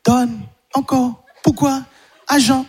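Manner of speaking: put-on high, slow toddler babble; high slow baby talk. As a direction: mimic a two-year-old's first words. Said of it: "Ils commencent".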